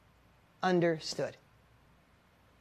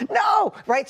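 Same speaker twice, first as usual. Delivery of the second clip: high, loud burst of refusal; retold panicked yell; panicked shout; 9 dB louder than usual. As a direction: blurt it out like an alarmed dad. Dad's panicked reaction to closed door.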